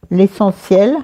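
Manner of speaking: ordinary delivery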